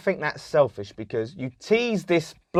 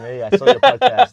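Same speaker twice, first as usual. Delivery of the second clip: higher, louder mock visitor voice; 7 semitones higher, 5 dB louder than usual. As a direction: playfully voice the surprise guest. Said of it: "Imagine next week turn up going".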